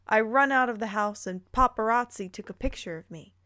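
ordinary talk, nothing marked

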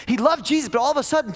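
heated sermon climb; impassioned preaching. Acted. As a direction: preach it with rising fire. Preaching at full force.

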